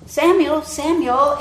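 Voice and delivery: loud, high call of God in the night. Acted. The voice of God calling the sleeping boy, loud. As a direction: call his name out across the dark.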